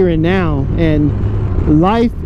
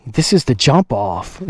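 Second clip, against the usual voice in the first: quoting G B Winging It; 6 semitones lower than usual. Retold or imitated. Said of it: "as G B Winging It would say".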